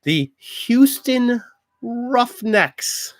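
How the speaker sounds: slow, drawn-out high sports announcer call. Pretend. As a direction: boom out the team name like a stadium announcer. Announcing team names.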